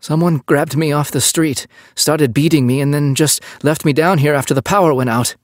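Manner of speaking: high, distinct, pitiful voice; playing the victim. Captive playing the victim to the French cop, pitiful plea. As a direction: sell it as a helpless victim. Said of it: "deciding to play the victim".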